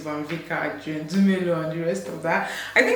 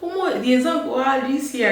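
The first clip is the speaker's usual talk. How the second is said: Nigerian Pidgin; 7 semitones higher than usual. Retold or imitated. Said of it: "we usually say".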